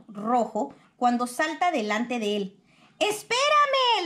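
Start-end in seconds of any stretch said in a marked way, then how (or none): 3.0-4.1 high, drawn-out call after a friend; little armadillo calling, much higher and slow; calling wait for me. A narrator's reading. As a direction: stretch it out, calling to someone running ahead. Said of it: "le grita".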